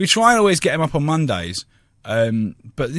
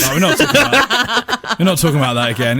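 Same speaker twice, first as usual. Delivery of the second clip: laughing; 3 semitones higher, 4 dB louder than usual. Real, not acted. Refusing topic while laughing.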